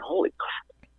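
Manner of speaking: breathless whispered "Holy crap"; awed whisper. Retold or imitated. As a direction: hush it like you can't believe it. Realizing head size.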